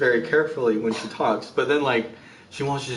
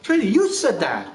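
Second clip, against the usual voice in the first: higher-pitched, baffled protest; mock exasperation; 7 semitones higher than usual. To Trinity, who forgot what she said, mock exasperated. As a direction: climb in pitch with incredulous exasperation.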